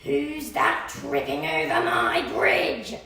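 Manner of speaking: deep slow troll demanding who's there. Acted. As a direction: rumble the challenge from below. Troll challenges second goat.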